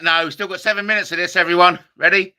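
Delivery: usual speaking voice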